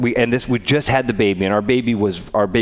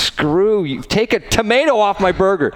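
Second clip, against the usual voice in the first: higher, snapping back at the drive-thru worker; mock angry retort; 10 semitones higher than usual. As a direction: tell them off with a bit of a sneer. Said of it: "And you're like".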